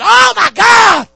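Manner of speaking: high, drawn-out shriek, very loud; terrified scream. Retold or imitated. Woman suddenly seeing with her blind eye, terrified scream. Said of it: "She screamed, said".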